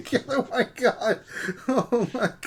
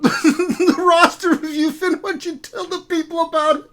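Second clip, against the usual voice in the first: laughing; 7 semitones higher, 6 dB louder than usual. Real, not acted.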